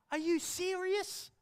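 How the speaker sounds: mother stunned at daughter's news; amazed mother. Acted. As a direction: draw it out in disbelief. Mother voiced in retold story.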